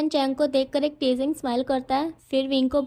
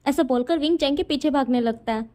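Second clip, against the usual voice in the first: plain reading voice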